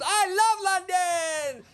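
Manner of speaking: very high, slow, drawn-out mock holler; imitating a woman whooping with enthusiasm; overenthusiastic cheer. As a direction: stretch each word into a gushing yell. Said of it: "throws both arms in the air and goes".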